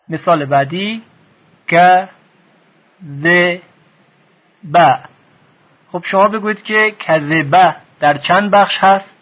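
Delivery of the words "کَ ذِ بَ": "ک، ذ، ب" as "ka, ze, ba", long pauses between; three short syllables, each on its own; split into syllables. Shown as a demonstration. Teacher gives next example, syllable by syllable.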